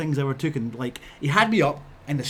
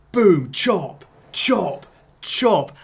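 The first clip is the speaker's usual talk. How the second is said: punchy imitated impacts, louder; loud mock chop sounds; 4 semitones higher, 6 dB louder, much slower than usual. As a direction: hammer out each chop. Imitating the chops landing.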